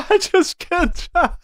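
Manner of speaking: high, slow words choked by laughter; laughing. Real, not acted. Streamer telling chat he can't, cracking up. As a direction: say it barely holding it together. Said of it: "I'm just crying right now".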